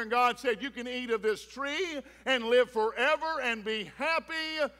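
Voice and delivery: rising preacher's cadence, punching key words; preaching. Acted. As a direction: drive the point home to the congregation. Preaching to the congregation.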